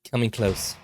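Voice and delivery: plain reading voice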